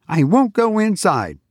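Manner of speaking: put-on defiant boy. A narrator's reading. Defiant boy refusing robot teacher.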